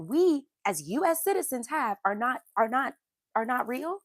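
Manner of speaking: incredulous, emphatic rhetorical questioning; indignant challenge. Real, not acted. Challenging commenters over children's rights.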